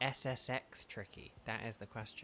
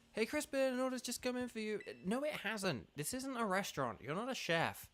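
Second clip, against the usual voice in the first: game character voice; 9 semitones higher than usual. Acted. Voicing characters in game dialogue.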